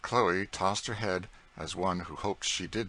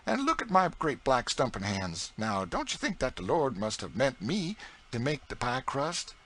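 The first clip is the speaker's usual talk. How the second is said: put-on higher, louder proud old cook in broad dialect; Aunt Chloe's voice; 6 semitones higher, 3 dB louder than usual. A narrator's reading. Aunt Chloe boasting to young George, broad proud dialect. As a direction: boast with a scornful toss of the head.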